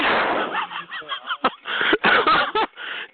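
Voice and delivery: breathless high-pitched cackle; shrieking high cackle. Real, not acted. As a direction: cackle way up high, gleeful and mean. Cackles after roasting caller.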